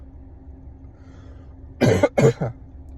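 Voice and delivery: harsh dry cough; coughing fit. Real, not acted. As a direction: hack out a rough cough. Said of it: "by the sound of my cough".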